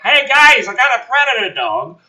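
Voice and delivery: high, loud breezy hey guys; mock casual announcement. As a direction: announce it like nothing happened. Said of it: "walking in like".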